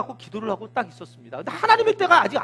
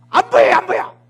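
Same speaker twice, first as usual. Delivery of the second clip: higher, louder mock yell; irritated snap; 6 semitones higher, 8 dB louder than usual. Servant losing his temper with Elijah, mock yell. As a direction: fling it back impatient. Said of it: "성질 나가지고 확 하는 거예요".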